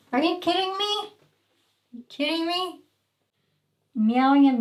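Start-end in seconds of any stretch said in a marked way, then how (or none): 0.1-1.0 high exasperated disbelief; rising incredulous question; disbelief at cat. Real, not acted. Scolding the cat for meowing, incredulous. 1.0-2.8 slow, soft, drawn-out exasperation; exasperated. Real, not acted. Scolding the cat again, slower and softer in defeat.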